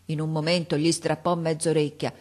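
usual speaking voice

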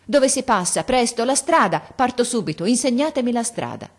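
high, loud, urgent demand; violent resolve. Acted. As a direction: insist fiercely on leaving now. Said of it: "con un impeto di risoluzione violenta". (impeto di risoluzione violenta, alto e forte)

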